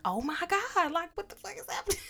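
high-pitched, hurried alarm; mock panic. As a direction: act out a flustered cry while being chased. Retells being charged at, running around.

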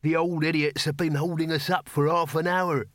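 hint of an aggrieved working voice; lightly voiced cattle driver. Cattle driver to sergeant, complaining.

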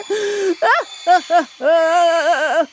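high, hammed-up wailing sobs; drawn-out fake boo-hooing; theatrical crying. A narrator's reading. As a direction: bawl wordlessly in mock grief. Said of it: "shrieking and crying".

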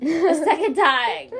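high, loud giggling through the words; laughing. Real, not acted. Telling a friend she tripped again, laughing. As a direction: laugh it out as you say it.

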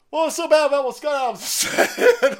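high, loud mock tutting voice; mock horrified voice; mock pearl-clutcher. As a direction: whine it in fake dismay.

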